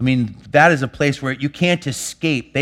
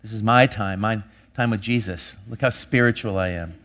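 lower, softer, smug and self-satisfied; father mocking his own pious devotion time, lower and smug; mock pious self-importance; 5 semitones lower, 4 dB quieter than usual. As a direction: lampoon your own holier-than-thou tone. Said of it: "I'd be like".

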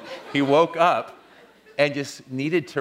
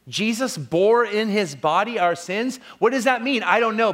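raised, higher-pitched sermon delivery; forceful preaching; 5 semitones higher, 4 dB louder than usual. Acted. Preacher declaring Scripture to his church, forceful and high. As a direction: proclaim the verse to the congregation, pitch lifted.

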